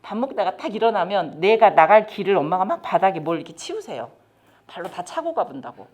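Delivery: usual speaking voice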